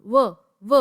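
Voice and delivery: व held alone, loud and isolated; teacher sounds out व. Shown as a demonstration. Teacher demonstrating व to students, isolated and loud. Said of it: "व की sound".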